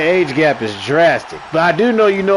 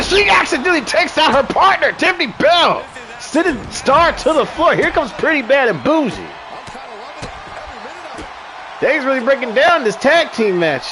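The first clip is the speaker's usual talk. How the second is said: excited play-by-play; 9 semitones higher than usual. Acted. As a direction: call the action with energy. Wrestling play-by-play.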